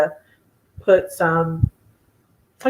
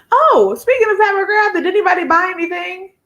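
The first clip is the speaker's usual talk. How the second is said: excited; 12 semitones higher, 3 dB louder than usual. Real, not acted. Excited question to chat.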